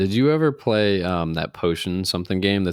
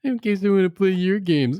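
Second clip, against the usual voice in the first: mocking high voice; 12 semitones higher than usual.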